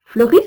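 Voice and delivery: "fleuris" with the last syllable "ris" punched loud; strongly marked stress. Shown as a demonstration. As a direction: hit the final "ris" of "fleuris" hard. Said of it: "prononciation avec accent tonique fortement marqué".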